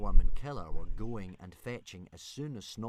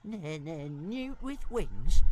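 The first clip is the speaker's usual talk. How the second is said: high, slow stammering little dragon; stuttering dragon; 9 semitones higher, 6 dB quieter, much slower than usual. Acted. Toothless stammering a demand at Hiccup, high and slow. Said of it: "repeated Toothless".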